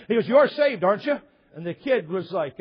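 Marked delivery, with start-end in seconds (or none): 0.3-1.2 hammed-up high, loud leading question; loud acted soul-winner pitch; pushy evangelist. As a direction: press the listener for a yes, mock-eager. Recruiter retold pressing the kid.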